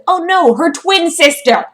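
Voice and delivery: loud, high sarcastic outcry; mock dismay. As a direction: cry out in hammed-up horror. Mock dismay at a plot twist.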